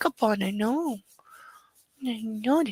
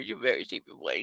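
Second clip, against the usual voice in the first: low cooing; 10 semitones lower, 7 dB quieter, faster than usual. Real, not acted. Cooing to the dog.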